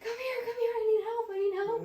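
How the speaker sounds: high, loud, fast frantic calls for help; retold frantic screaming. To her partner across the field, high panicked screaming. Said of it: "I started screaming for my partner".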